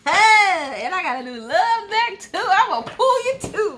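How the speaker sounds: high, loud and thrilled; excited. Real, not acted. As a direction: burst with excitement. Excited about her new deck.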